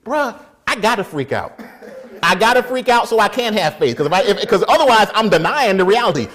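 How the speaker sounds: high, loud freak-out; mock panic. Man explaining why he must freak out, in mock panic. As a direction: panic out loud, playing it big. Acting out panic at charging dog.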